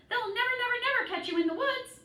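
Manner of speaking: high loud goose shout, quick at the end. A narrator's reading. Goose shouting encouragement to the escaping pig, high and loud. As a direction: cheer him on with a fast, high yell. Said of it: "she shouted to Wilbur".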